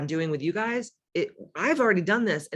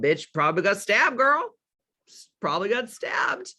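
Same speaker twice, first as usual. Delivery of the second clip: sassy; 5 semitones higher than usual. Real, not acted. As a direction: deliver it with attitude. Sassy punchline reaction.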